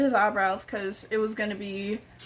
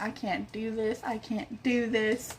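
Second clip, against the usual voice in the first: soft, defeated self-talk; hushed, mildly overwhelmed, slowing on the repeat; quiet dread; 7 dB quieter than usual. Retold or imitated. Dreading the difficult client, retold.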